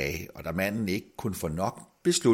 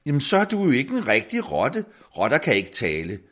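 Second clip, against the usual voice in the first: konstaterende, højere og lysere; højt for sig selv; 6 semitones higher, 5 dB louder than usual. A narrator's reading. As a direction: hun tænker højt, noget højere. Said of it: "konstaterede hun højt for sig selv". (kvinden konstaterer højt for sig selv)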